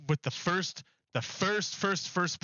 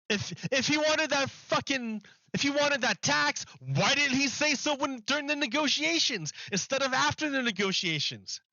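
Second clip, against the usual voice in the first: exasperated rant; 7 semitones higher than usual. Real, not acted. Ranting about Biden's late tax.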